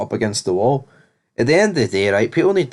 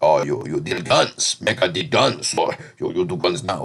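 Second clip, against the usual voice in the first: put-on a lightly done Jamaican accent, quoting the connect; 5 semitones lower than usual. Retelling the gun dealer's offer in a mild Jamaican accent. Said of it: "the guy with, like, the Jamaican accent".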